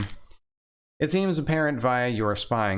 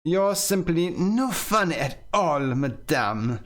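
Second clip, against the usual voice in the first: higher, slow, haughty disapproval; disgusted gentleman; 6 semitones higher, slower than usual. Acted. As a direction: sniff at her like a spoiled dandy. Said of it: "shaking his head in disgust".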